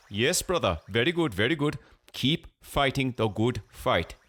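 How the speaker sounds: high-pitched put-on Pakistani accent; cartoonish Pakistani accent. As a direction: cheer him on in a mock Pakistani accent. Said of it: "cartoonish Pakistani accent".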